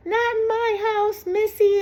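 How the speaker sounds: scandalized grandmother. Retold or imitated. Grandmother catching the young couple napping, high mock scolding. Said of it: "came rolling in, to the bedroom and said".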